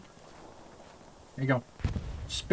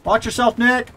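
shouting; 7 semitones higher, 3 dB louder than usual. Real, not acted. Warning teammate in battle.

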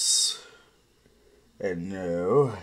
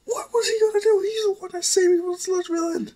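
put-on much higher girl voice, sharply indignant; a niece snapping at her uncle, pitched way up; indignant young girl; 5 dB louder, faster than usual. Melissa voiced by narrator.

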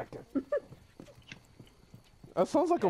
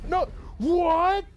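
sudden high loud disbelief; shocked shout; 5 dB louder than usual. Real, not acted. Teammate just killed him, shocked shout. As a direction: yelp it out in shock.

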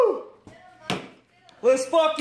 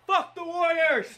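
usual speaking voice

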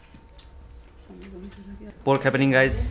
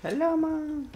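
high squeaky greeting; 13 semitones higher, 4 dB quieter, slower than usual. Pretend. Playful greeting to mother.

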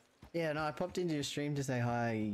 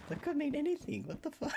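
a high, disbelieving reaction; mock incredulous; 13 semitones higher than usual. Streamer retelling her reaction to a Sicilian remark, high and baffled. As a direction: play it baffled, pitch up. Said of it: "I was just like".

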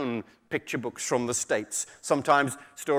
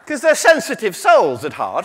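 ordinary delivery

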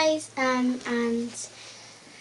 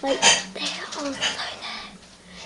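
slow hushed whisper; whisper, they're right there; whispering while hiding; 4 dB quieter, much slower than usual. Real, not acted. Seekers right outside.

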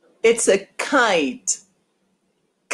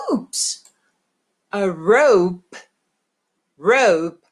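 usual speaking voice

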